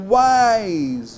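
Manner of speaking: "Quais" stretched out as one unbroken syllable; long, demonstrative "Quais", never split; single-pulse triphthong. Shown as a demonstration. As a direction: glide through all three vowel sounds of "Quais" in one pulse. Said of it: "só uma pulsão aqui".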